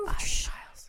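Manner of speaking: hushed spooky whisper. Shown as a demonstration. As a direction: whisper it like a secret. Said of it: "and then it whispers".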